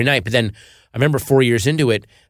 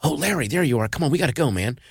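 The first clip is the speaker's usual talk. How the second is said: put-on higher, softer voice of a kid; young handler; 4 semitones higher, 5 dB quieter than usual. Kid handler fetching Larry from the cinema, soft urging. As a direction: gently coax someone out, lightly acted. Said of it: "some kid, and was like".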